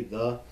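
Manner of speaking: "the" said as thuh; short E. Shown as a demonstration. Teacher repeats the example, "the" as thuh. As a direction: say "the" with an uh vowel. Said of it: "that short E".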